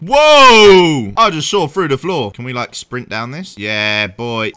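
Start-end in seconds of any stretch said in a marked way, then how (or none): none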